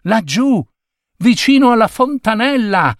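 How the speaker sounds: put-on high-pitched, eager child's call; excited calling out. A narrator's reading. Child shows classmates the fountain, high excited call. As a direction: point it out excitedly, voice held high.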